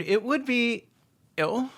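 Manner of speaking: usual speaking voice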